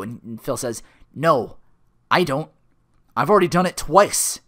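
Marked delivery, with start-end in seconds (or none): 1.1-4.4 loud, emphatic acted refusal; firm refusal. Phil refusing Rita the work, loud and firm. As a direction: push each short phrase out firmly.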